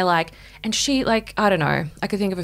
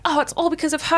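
mocking the guys; 9 semitones higher than usual. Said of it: "They're like".

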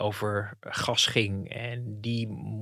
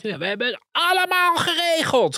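high, louder mock screech; imitated scream; 5 dB louder than usual. Reporter imitates politician's outburst at journalists, high-pitched scream. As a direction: yell it like a politician losing control. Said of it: "gilde die".

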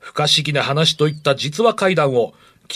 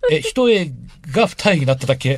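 teasing disbelief; 2 semitones higher than usual. Real, not acted. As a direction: poke fun at the small miracle.

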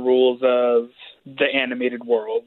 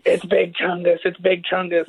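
higher-pitched mock scream of an excited chant; imitated kid screaming; 5 semitones higher than usual. Parent imitating their kid screaming at a meme, mock scream. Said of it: "my kid just started screaming".

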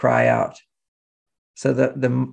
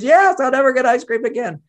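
put-on high, loud childish wail; mock wailing daughter; 11 semitones higher, 4 dB louder than usual. Father imitates daughter wailing she'll never get ice cream. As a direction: ham up a child's despair. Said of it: "She goes".

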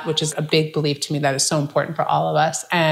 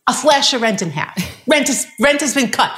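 higher, eager encouraging landlord voice; imitating the landlord; 8 semitones higher than usual. Landlord urging her to stay, higher and eager. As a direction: pitch it up like the pleading landlord. Landlord offers rent cut.

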